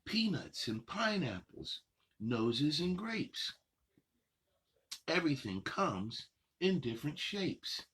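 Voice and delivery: sing-song picture book reading, unhurried pauses; reading to children. Acted. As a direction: read the rhymes to little kids, savoring each line. Reads picture book aloud.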